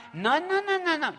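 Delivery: high, rapid no-no-no; eager insistence. Retold or imitated. Speaker insisting to the engineer, high and eager. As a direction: refuse his excuse brightly.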